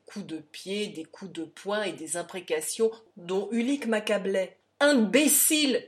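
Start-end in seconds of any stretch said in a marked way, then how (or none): 4.8-5.9 loud, high, drawn-out shout; cri d'insulte. A narrator's reading. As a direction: shout the insult angrily, stretching it out. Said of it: "criait-il". (crié fort, d'une voix furieuse)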